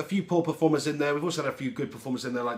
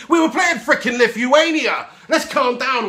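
high, loud exasperated cry; incredulous outburst; 9 semitones higher, 9 dB louder, slower than usual. Real, not acted. Fan puncturing hype after Lithuania game, incredulous shout. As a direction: shout it high, stretched out in disbelief. Mock outrage at overexcited England fans.

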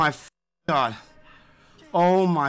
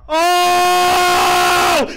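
long, high, loud "oh"; sustained victory yell; ecstatic scream; 9 semitones higher, 6 dB louder than usual. Real, not acted. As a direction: scream out in sheer elation. Screaming at a big win.

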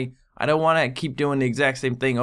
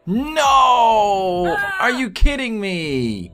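frustrated yell; 9 semitones higher, 4 dB louder, much slower than usual. Real, not acted. Patient dying again.

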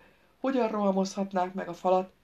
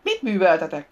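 loud, sudden outcry; shrieked question; 9 dB louder than usual. A narrator's reading. Scarlett cries out at what they have done, shrieked. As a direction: cry out the question in alarm. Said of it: "sikkantott fel Scarlett".

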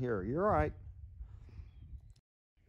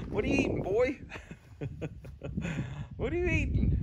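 pet talk to a horse; 9 semitones higher than usual. Real, not acted. Owner talking to horse eating.

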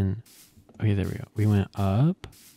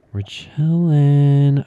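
slow, loud, stretched chilling; loud drawn-out chilling; 4 semitones higher, 6 dB louder, much slower than usual. Acted. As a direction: drag it out loudly, mock relaxed.

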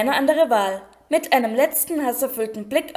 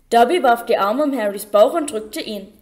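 plain reading voice

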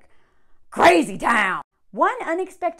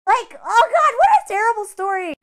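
higher, louder dismay; loud exasperation; 10 semitones higher, 8 dB louder than usual. Real, not acted. Judging Jessica's story for viewers, loud exasperated dismay. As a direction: groan it out loudly.

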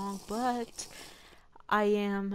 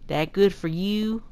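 put-on hillbilly accent; 3 semitones higher, 6 dB louder than usual. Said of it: "I'll read my hillbilly accent".

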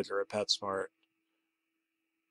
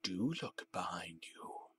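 much softer, breathy whisper; 4 semitones lower, 17 dB quieter than usual. Acted. Mysterious caller instructing the narrator, a soft whisper.

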